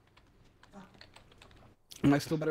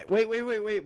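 urgent; 5 semitones higher, 7 dB louder than usual. Real, not acted. Stopping friends leaving.

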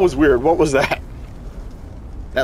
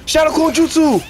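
battle shout; 10 semitones higher than usual. Real, not acted. Casting move in battle.

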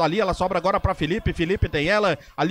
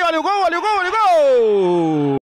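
high, stretched repeated goal shout; goal call; 9 semitones higher, 6 dB louder than usual. Real, not acted. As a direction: build to a held goal cry.

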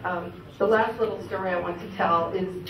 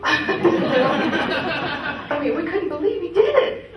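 higher, louder, laughing through the words; laughing disbelief; 7 semitones higher, 4 dB louder, much slower than usual. Real, not acted. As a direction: giggle it out in disbelief. Still laughing about dad's success.

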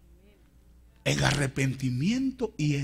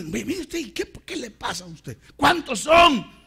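high-pitched challenge building to a shout; acted indignant retort; 10 semitones higher, 8 dB louder than usual. Preacher voicing the urge to defend oneself, indignant. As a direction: square up to the accusers, louder at the end. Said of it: "Uno quisiera ir a decir".

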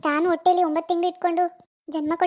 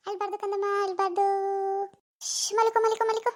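hushing to sleep; 2 semitones higher, 5 dB quieter than usual. Acted. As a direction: shush the baby gently to sleep. (ಮಗುವನ್ನು ಮೆಲ್ಲಗೆ ಮಲಗಿಸುವ ಧ್ವನಿ)

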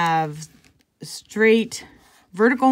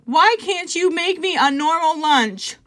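put-on upset child asking; 8 semitones higher, 3 dB louder than usual. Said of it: "she replied".

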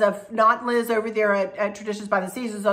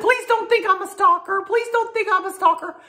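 high, louder mock pleading from a shy fan; pleading friend; 11 semitones higher, 4 dB louder than usual. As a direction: beg it like a sweet embarrassed friend. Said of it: "And she said".

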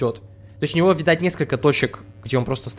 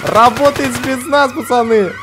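mock spetsnaz; 8 semitones higher, 6 dB louder, slower than usual. Mock special forces announcement.

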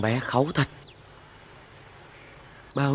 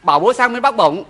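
urgent call; 8 semitones higher, 7 dB louder than usual. Acted. Father urgently calling to wife.

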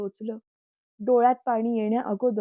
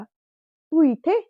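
higher, louder, slow astonishment; stunned to see her; he finds his girlfriend at home, surprised; 6 semitones higher, 9 dB louder, much slower than usual. A narrator's reading. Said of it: "आश्चर्याने बोलला".

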